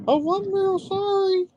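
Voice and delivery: very high, slow falsetto apology; mock little-girl voice. Man imitating his cousins who sound like girls, mock falsetto. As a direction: mimic a girlish relative in squeaky falsetto.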